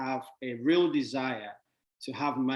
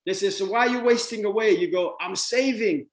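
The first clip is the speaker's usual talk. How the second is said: mock defensive saver; 6 semitones higher, 4 dB louder than usual. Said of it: "but you say".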